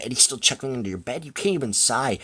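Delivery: plain reading voice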